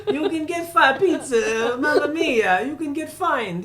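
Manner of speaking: put-on loud, high, drawn-out comic Italian accent. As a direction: ham up the Italian, sing-song and slow. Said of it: "in an Italian accent".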